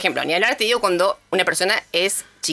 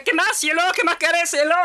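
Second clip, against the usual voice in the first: a high, syrupy shop assistant calling everyone cielo; sugary shop lady; 7 semitones higher than usual. Retold or imitated. Shop lady cooing cielo to a customer, high and sugary. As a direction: coo like a sweet shopkeeper. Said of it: "y con ese tono".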